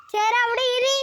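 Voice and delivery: high sing-song order to sit; pet command. Real, not acted. Vlogger telling her pet to sit there, in a high coaxing voice. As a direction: coax the pet to stay put, pitched high.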